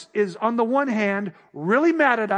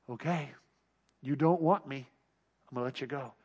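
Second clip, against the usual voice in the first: much softer and lower, sadly letting go; resigned God voice; 8 semitones lower, 9 dB quieter than usual. Retold or imitated. God lets the unwilling go, softly resigned. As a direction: release them with quiet regret. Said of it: "His wrath was".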